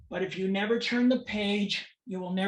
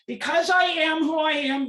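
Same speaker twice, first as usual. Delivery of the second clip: high, loud, forceful protest; impassioned declaration; 8 semitones higher, 9 dB louder than usual. Real, not acted. Parent stripped of rights addressing an audience, impassioned declaration. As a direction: raise the voice sharply and declare it.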